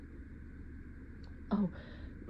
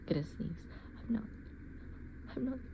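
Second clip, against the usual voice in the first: softer whisper, holding in a sneeze; whispered denial; 4 semitones higher, 10 dB quieter, much faster than usual. Acted. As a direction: breathe it out while holding back a sneeze. Fighting a sneeze.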